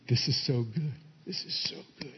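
very soft, low aside; hushed, dropping away to barely voiced; hushed relish. Real, not acted. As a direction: savour it almost to yourself, fading toward a whisper. Savours his point in an aside.

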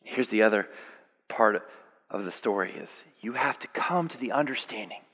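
usual speaking voice

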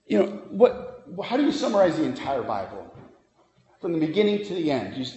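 ordinary talk, nothing marked

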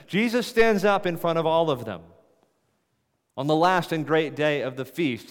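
high, loud, building sermon; preacher building up to a climax; preaching at full force. Real, not acted. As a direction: drive it home to the congregation.

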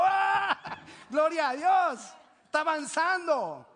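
loud, high, drawn-out shout of praise; re-enacted exultant church shout; jubilant cheer. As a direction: cry out slowly in celebration. Acted rejoicing at others' progress.

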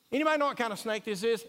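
a hammed-up online asker; mock online question. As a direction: rattle it off like a quick Facebook post. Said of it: "You see what I'm talking about?".

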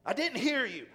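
loud, high snappish retort; angry customer snapping back at the cashier. Retold or imitated. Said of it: "I said it in that tone".

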